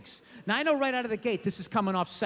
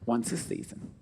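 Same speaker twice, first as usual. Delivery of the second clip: low, deliberate step-by-step advice; low instructional voice; 10 semitones lower than usual. Acted. Comic telling wives the rule in a low, deliberate voice. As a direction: drop the voice way down, steady and instructive.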